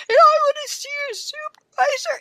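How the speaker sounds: high, strained demand for a supervisor; upset demand. Retold or imitated. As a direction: demand it near tears.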